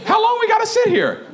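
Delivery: put-on high, whiny child question; acted high kiddie voice; little boy. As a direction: ask like an impatient small son. Plays child asking again.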